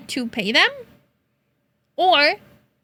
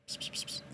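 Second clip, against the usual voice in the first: unvoiced psst psst to lure a cat; whispered psst calls; 22 dB quieter, much faster than usual. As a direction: hiss softly like calling a kitten. Calling cats.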